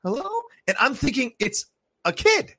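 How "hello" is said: high thin calling hello; spooky high-pitched hello; eerie high hello. Retold or imitated. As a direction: mimic the creature calling out high. Imitating the creature's call again.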